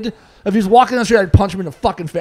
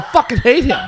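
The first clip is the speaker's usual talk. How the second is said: retold angry outburst; 6 semitones higher than usual. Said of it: "I went off on him at work".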